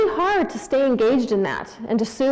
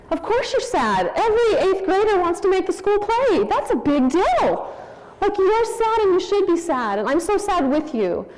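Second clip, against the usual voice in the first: emphatic validation; 9 semitones higher than usual. Retold or imitated. Mother validating her daughter over the school play, emphatic sympathy. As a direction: act out big sympathy, pitched well up.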